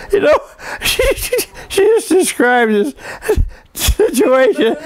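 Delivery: high, breaking into laughter mid-sentence; words squeezed out through giggles; laughing. Real, not acted. Son recalling his mother's letter, laughing helplessly.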